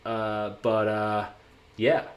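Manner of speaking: put-on American accent. Shown as a demonstration. Streamer asking US viewers to place his American accent. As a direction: speak as if impersonating an American. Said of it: "that is my American accent".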